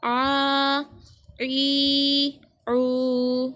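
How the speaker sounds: आ, ई, ऊ each begun with a throat-squeezed ain. Shown as a demonstration. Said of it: "आइन की आवाज़ निकल रही है". (आ, ई, ऊ को आइन की आवाज़ में गले से पढ़ें)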